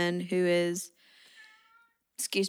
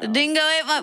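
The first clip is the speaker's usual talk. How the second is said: high, loud mock Australian accent; Australian accent attempt; 7 semitones higher, 5 dB louder than usual. Shown as a demonstration. Friend takes a turn at the dingo line in an Australian accent. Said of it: "Australian's hard for me, too".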